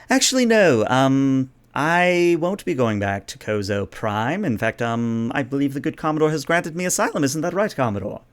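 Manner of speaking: lightly put-on princess voice, lifting at times; poised princess, politely defiant. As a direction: politely correct him, then seek backing.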